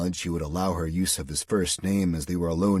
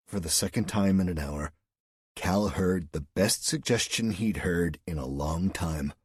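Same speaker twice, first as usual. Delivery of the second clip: ordinary delivery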